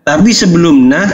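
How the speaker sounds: usual speaking voice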